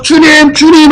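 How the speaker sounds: high acted-out shout to someone far off; imitated excited cry; eager calling out. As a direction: call across the water with longing. Swimmer calls to the Lord.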